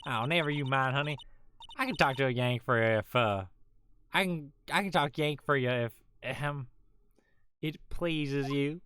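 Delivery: lightly drawled Southern country gal; folksy Southern accent, mild; a hint of a Southern belle accent. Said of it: "the, like, southern voice".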